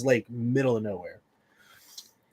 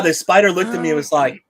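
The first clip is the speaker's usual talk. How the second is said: ordinary talk, nothing marked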